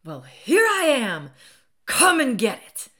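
high, very loud screamed dare; screaming. A narrator's reading. Enraged woman daring the man before her, screaming. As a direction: burst into a drawn-out scream. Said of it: "I screamed".

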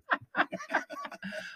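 high, drawn-out laugh. Real, not acted. Co-host cracking up at the mistaken word, high laughing.

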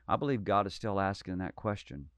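ordinary delivery

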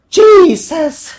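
loud, high exclamation; exasperated outburst. A narrator's reading. Devil reacts to the man's request, exasperated yell. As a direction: explode in exasperation.

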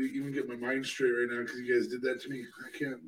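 ordinary delivery

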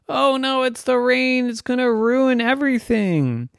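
high, hammed-up dismay; panicked kids. A narrator's reading. Boys hearing drops on their roof, panicked.

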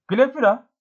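high, urgent cry of accusation; understated outcry, pitched high. A narrator's reading. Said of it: "diye haykırdı redingotlu delikanlı".

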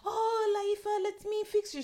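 high-pitched French lady voice; French accent. Retold or imitated. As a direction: coo it like a fussing French lady. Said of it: "She's French".

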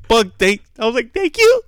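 high, laughing thank you; high giggly mock gratitude; relieved laughing thanks. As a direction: squeak out grateful relief. Relieved at doctor's reassurance.

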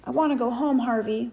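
upset, homesick appeal; close to tears. A narrator's reading. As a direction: plead as if tears are near. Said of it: "close to tears now".

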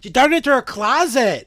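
high, loud startled exclamation; shocked. Real, not acted. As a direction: blurt it out in shock. Horrified realization of ghost story.

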